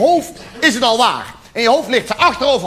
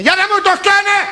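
mock indignant; 12 semitones higher, 4 dB louder than usual. Mock outraged aside to audience.

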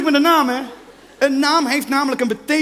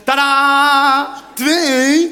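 triumphant ta-da; 6 dB louder, much slower than usual. Retold or imitated. Presents cats to girlfriend.

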